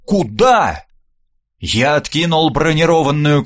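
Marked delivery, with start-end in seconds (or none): 0.1-0.9 higher-pitched, mild cry of alarm; understated shout after someone leaving; crew's cry. A narrator's reading. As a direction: call out in startled protest. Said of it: "Под слаженный крик экипажа".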